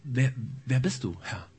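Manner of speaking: shaky, frightened question; trembling voice. Acted. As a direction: tremble through the words. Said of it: "mit zitternder Stimme erwiderte Saul".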